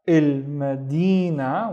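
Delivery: "il madina" slowly, with a drawn-out "di"; slow sounding-out. Shown as a demonstration. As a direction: stretch "il madina" syllable by syllable. Teacher models the word by syllables.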